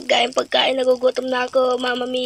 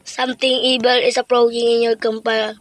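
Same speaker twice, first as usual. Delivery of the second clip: normal talking voice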